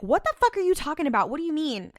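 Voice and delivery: high-pitched, mock-baffled outburst; retold incredulity. Grandfather answering Dean's demand, retold in high disbelief. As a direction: imitate someone thrown by a wild claim, pitch up.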